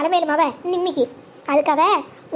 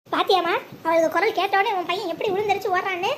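normal talking voice

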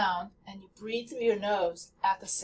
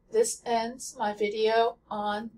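usual speaking voice

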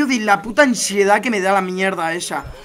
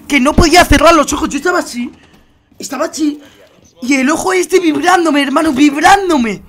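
higher and louder, telling it all worked up; 6 semitones higher, 6 dB louder than usual. Retold or imitated. Streamer telling viewers about his itchy eye, worked up and loud. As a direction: relive the itch, voice climbing and loud. Retelling a sleepless itchy night.

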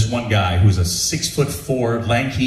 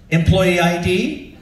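higher-pitched, drawn-out challenge; slow, rising query from a cashier; questioning cashier; 6 semitones higher, slower than usual. Retold or imitated. Lady at the counter asks for ID, questioning.

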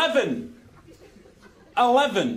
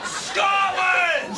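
higher, drawn-out patriotic shout; defiant war cry; 4 semitones higher than usual. Acted. Trapped Scot rallying against the lift, war cry. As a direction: roar it like a battle cry.

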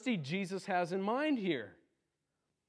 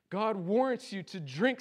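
ordinary delivery

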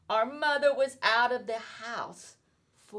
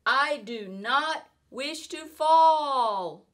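higher, slow, whiny protest; a fish grumbling in the air; complaining fish; 6 semitones higher, 5 dB louder, slower than usual. Acted. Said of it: "said the fish".

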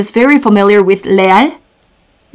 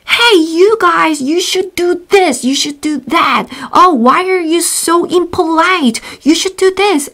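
nagging teacher; 6 semitones higher, 3 dB quieter than usual. Retold or imitated. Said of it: "She's always shouting".

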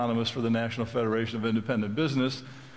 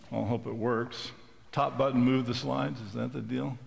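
ordinary talk

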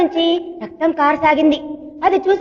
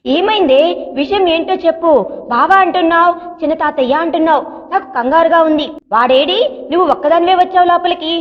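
usual speaking voice

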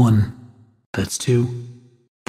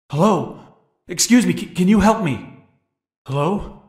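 high-pitched call for help; raised, pleading call to a stranger; 9 semitones higher than usual. Acted. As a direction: call out urgently to someone ahead. Calling out to someone for help.

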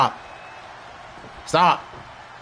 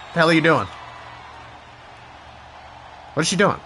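usual speaking voice

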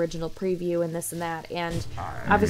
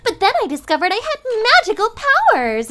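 put-on high, bright, fast anime girl voice; chirpy anime heroine intro; anime schoolgirl voice; 12 semitones higher, 6 dB louder than usual. Shown as a demonstration. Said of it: "a pro at, like, the anime schoolgirl voice".

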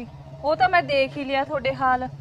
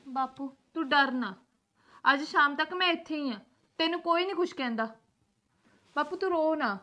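soft, soothing comfort; tender reassurance; 8 dB quieter than usual. Acted. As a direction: quietly reassure a frightened, crying father. Daughter comforts her crying father. (ਧੀਮੀ ਆਵਾਜ਼ ਵਿੱਚ ਪਿਆਰ ਨਾਲ ਦਿਲਾਸਾ)

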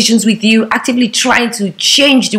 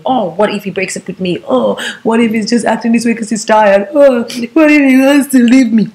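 mock anxious fretting; 2 semitones higher than usual. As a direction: fret in mock distress, rising as you go. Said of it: "you're always thinking".

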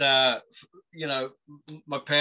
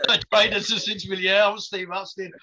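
laughing boast; 6 semitones higher, 4 dB louder than usual. Real, not acted. Joking about bionic training.

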